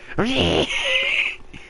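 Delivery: high-pitched pretend screech; mock screaming. Friend echoing the screaming joke, high mock screech. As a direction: squeal out a joking mock scream.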